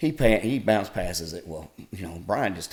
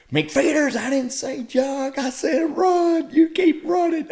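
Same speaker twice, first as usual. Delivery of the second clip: high, loud bellowing coach order; coach yelling; 6 dB louder, slower than usual. Retold or imitated. Coach yelling at a player who is jogging, loud and high. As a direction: yell it like a furious coach.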